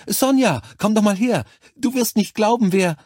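very high, loud, excited nurse; aufgeregtes Herbeirufen. A narrator's reading. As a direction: call a colleague over to share thrilling gossip. Nurse calls colleague over, thrilled. (aufgeregt und hoch gerufen)

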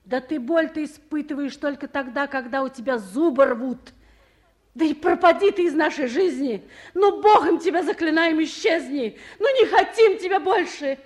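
loud, laughing rage at the man on the phone; furious shouting. Acted. Furious tirade, ends the call.

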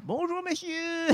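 put-on high, soft, drawn-out young lady's greeting; imagined Julie. Julie answers the bar's service man, high soft put-on voice. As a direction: answer coyly in a small high voice. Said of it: "l'homme de service dit".

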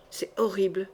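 soft, low stammer of horror; quietly stammering. Acted. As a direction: falter the words out, shaken. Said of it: "bégaya Passavant".

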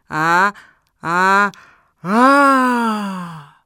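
loud repeated "ah", last one long; two short cries, then a long wail; villain's cry. Acted. Villain's bass voice vanishes, loud drawn-out cry.

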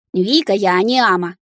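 ordinary delivery

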